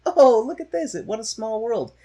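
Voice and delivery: high, loud mock delight; hammed-up sarcastic cheer; mocking sarcasm. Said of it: "I was like".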